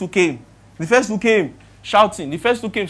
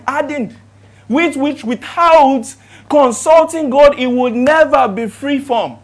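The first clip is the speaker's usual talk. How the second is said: loud, higher preacher's build, heavy on each phrase; full-force preaching; 6 semitones higher, 9 dB louder, slower than usual. Acted. Preacher warning church about what lies hidden, full-force preaching. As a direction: drive every phrase home from the pulpit.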